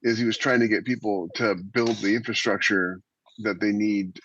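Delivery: normal talking voice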